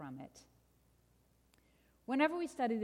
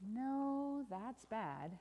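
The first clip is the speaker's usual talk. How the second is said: ominous correction; 3 semitones higher, 3 dB quieter, much slower than usual. Acted. Storyteller overturns good news, sinking gravely. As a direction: let the voice sink in gloom. Story refrain, contradicting the delight.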